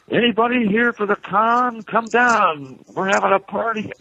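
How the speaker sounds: high calling-out shout; retold shout. Friend in the courtyard shouting to the hotel guests. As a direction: call the crowd down to the party. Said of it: "he just starts shouting".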